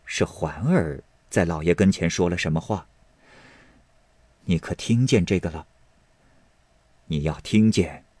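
put-on quiet, low, confidential older lady; a mistress asking in secret, voice kept down; Lady Wang, hushed. (压低声音的王夫人，悄悄询问)

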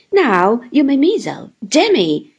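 loud, brisk little woman's order; playful command; Miss Mowcher. Acted.

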